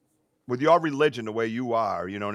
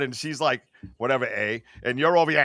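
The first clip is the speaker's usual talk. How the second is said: normal talking voice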